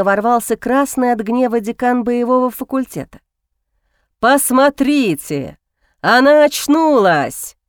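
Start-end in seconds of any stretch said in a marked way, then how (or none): 4.2-7.6 slow, showy mock delight; theatrical exclamation. A narrator's reading. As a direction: throw it out theatrically. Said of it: "картинно всплеснул руками Альсар". (театрально, нараспев)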